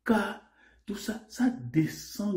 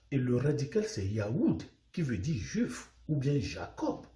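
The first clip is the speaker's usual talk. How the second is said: ordinary talk, nothing marked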